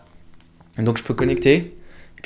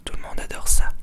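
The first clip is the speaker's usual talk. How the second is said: whisper in your ear. Shown as a demonstration. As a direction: close breathy whisper right at the mic. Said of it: "vous chuchoter dans le creux de l'oreille".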